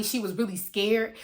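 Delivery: plain reading voice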